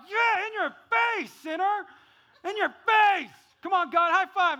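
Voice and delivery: high, loud hammed-up victory shouting; gloating taunt. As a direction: crow over them, then cheer for applause.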